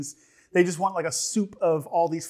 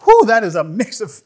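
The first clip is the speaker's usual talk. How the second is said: surprised exhale; 3 semitones higher, 11 dB louder than usual. Real, not acted. First bite, sharp reaction.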